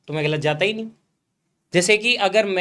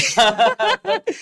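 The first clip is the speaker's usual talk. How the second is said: sheepish laugh; 9 semitones higher than usual. Real, not acted. Admits cramming, laughs.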